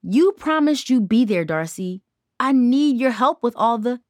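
a hurt, rising accusation; angry and hurt. A narrator's reading. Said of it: "Tara's eyes flashed with anger and hurt".

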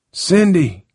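high, falling call; urgent high-pitched cry of a name. Acted. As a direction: call her name after her down the street. Harold calls after fleeing Cindy.

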